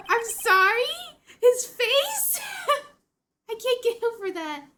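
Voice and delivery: high, loud disbelief breaking into laughs; incredulous laughter. Real, not acted. Player losing it over a game character's face, incredulous laughter. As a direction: crack up in real amazement.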